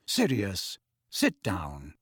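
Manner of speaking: low, quiet sharp order. A narrator's reading. Friend checking a man rising from his chair, sharply. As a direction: order him down, voice dropped. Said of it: "said Lupin sharply".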